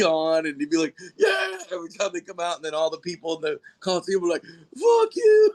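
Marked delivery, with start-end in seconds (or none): 1.2-1.6 much higher, mock triumphant yeah; a high, mock triumphant cheer from the villain wrestler; heel wrestler cheer. Russian heel wrestler entering arena. 4.4-5.5 much higher, louder mock heckle; a high, loud mock heckle from the arena crowd at the Russian villain; crowd yell.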